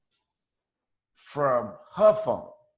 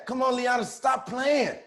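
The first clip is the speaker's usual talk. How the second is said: mild mock yell from the background; shouting in the background; 7 semitones higher than usual. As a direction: call out stop playing. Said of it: "I heard her say".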